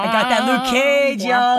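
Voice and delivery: mock Jamaican accent, boasting; hammed-up Jamaican swagger. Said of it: "going to mangle some Jamaican accents".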